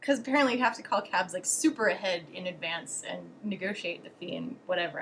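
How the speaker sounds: ordinary talk, nothing marked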